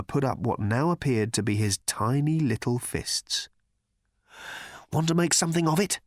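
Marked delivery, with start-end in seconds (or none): 4.9-6.0 quick, higher-pitched challenge; timid challenge. A narrator's reading. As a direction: toss out the dare quickly. Arthur first challenges Thor.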